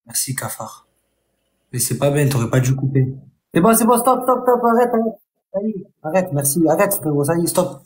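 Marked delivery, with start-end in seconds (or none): 3.5-7.8 higher-pitched, rapid-fire stops; urgent pleading to stop. Real, not acted. Streamer telling a viewer to stop sending gifts, urgent. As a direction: cut them off, insisting urgently.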